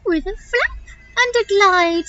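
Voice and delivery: high, swooping little bird voice; chirpy parrot. Acted. Parrot answering how she'll cross, high and chirpy. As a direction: pipe it up like a storybook parrot.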